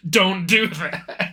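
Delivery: normal talking voice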